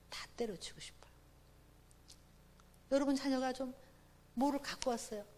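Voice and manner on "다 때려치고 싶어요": whisper. Retold or imitated. Deflated after husband's remark.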